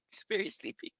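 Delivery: unvoiced whisper. Real, not acted. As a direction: whisper so the dog stays asleep. Whispering near sleeping dog.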